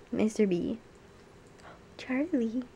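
usual speaking voice

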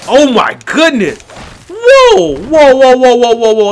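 loud, high startled whoa; startled yelps. Real, not acted. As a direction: yelp in real alarm, high and loud. Sudden jump scare in game.

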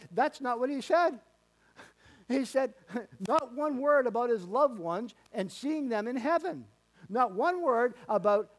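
high, loud preacher's cry; fiery preaching. Real, not acted. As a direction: preach it out, voice rising. Preaching at full force.

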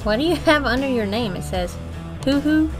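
ordinary delivery

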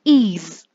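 is đọc /iz/ to rõ; ending iz sound. Shown as a demonstration. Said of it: "Cái thứ ba mình sẽ đọc là". (âm iz)

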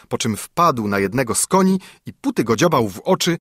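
plain reading voice

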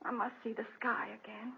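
faint whispered last words; dying whisper. Acted. Dying wife asking her husband to take her to the window, faint whisper. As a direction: whisper as if your strength is gone.

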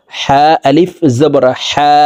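হা whispered, slow and airy; breathy hā. Shown as a demonstration. Teacher spelling the letter for viewers, in a slow whisper. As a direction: breathe হা out unvoiced, drawn one count. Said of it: "হা এক আলিফ টেনে পড়তে হবে".

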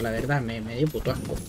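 normal talking voice

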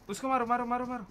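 ordinary talk, nothing marked

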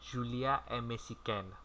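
slow repeat. Shown as a demonstration. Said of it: "bắt đầu thôi".

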